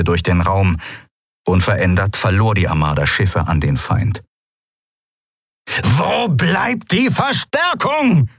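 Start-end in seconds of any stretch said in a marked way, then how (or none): 5.8-8.3 high-pitched, drawn-out bellow; roaring. A narrator's reading. Monaro demands reinforcements amid the losing battle, roaring. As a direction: roar it slowly, demanding help. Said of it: "brüllte Monaro".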